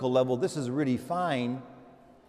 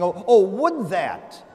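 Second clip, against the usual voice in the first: slow, louder longing cry; yearning prophet; 4 semitones higher, 6 dB louder, slower than usual. Retold or imitated. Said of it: "Isaiah goes on saying".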